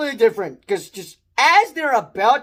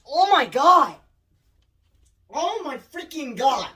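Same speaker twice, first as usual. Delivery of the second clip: ordinary delivery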